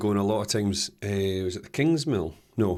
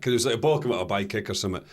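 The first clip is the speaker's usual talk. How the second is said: ordinary delivery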